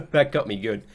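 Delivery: laughing. Real, not acted. Cracks up.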